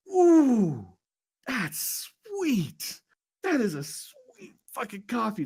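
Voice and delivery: high, pained squeal at the sweetness; wincing boss. Retold or imitated. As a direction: wince through the words like a sour face.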